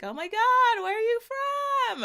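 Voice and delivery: gushing high-pitched, loud squeal of amazement; over-the-top squealed fan reaction; mock excited American. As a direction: imitate a starstruck American, voice shooting way up. Imitating excited Americans hearing her accent.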